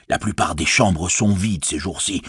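plain reading voice

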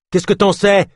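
loud, high snapped retort; sharp retort. A narrator's reading. Leif snapping back at Ganesh, loud and high. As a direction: throw it back at him.

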